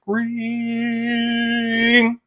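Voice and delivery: one long, held high note; drawn-out finish. Real, not acted. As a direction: stretch the word till the breath runs out. Ends long name list on one breath.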